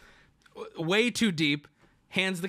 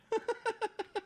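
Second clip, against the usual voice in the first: high laugh; 8 dB quieter than usual. Real, not acted. Laughing after the retold taunt.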